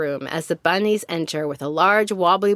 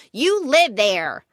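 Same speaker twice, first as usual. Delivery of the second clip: slow, higher-pitched jab; snarled taunt; 6 semitones higher, much slower than usual. A narrator's reading. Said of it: "She snarls and shouts".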